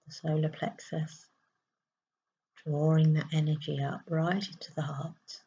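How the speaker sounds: soft, hushed meditation voice with long pauses; guided meditation. Acted. Spiritual coach guiding listeners to breathe into the heart, soft meditation voice. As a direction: guide them gently through each breath, softly and unhurriedly.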